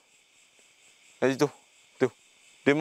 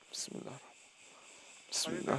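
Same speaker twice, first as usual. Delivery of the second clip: slow whispered recitation; whispered prayer; 18 dB quieter than usual. Real, not acted. Ghost hunter whispering a protective prayer, slow and hushed. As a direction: breathe the invocation under the breath.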